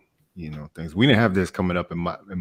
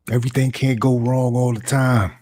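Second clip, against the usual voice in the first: loud, higher exasperated protest; Floyd Barton; 5 semitones higher, 6 dB louder than usual. Acted. Auditioning actor protests as the character, exasperated outburst. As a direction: cry out in frustration.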